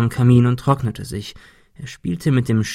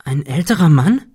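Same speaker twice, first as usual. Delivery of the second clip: surprised and worried; 4 semitones higher, 4 dB louder than usual. A narrator's reading. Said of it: "fragte Cecil erstaunt und besorgt".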